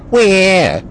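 high, stretched-out "wah"; cartoonish complaining whine; mock Republican whine. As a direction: wail it in ridicule. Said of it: "They're saying".

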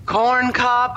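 hammed-up poetry reading; bad poet voice. Reading an odd poem to a friend, bad poet voice. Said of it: "read it in a bad poet voice".

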